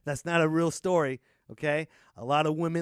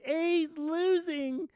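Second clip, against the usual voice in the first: mock whine; 14 semitones higher, much slower than usual. Mock whine about losing team.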